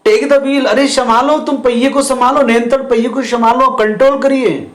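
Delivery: wife shouting at the narrator to take control, high and urgent. Acted. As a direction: yell at him to grab the wheel. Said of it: "जोर से बोल रहे हैं".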